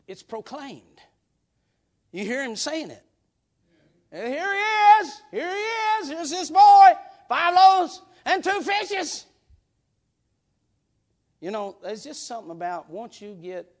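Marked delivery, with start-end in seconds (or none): 4.1-9.3 proclaiming Jesus. Acted. Jesus voiced handing out bread.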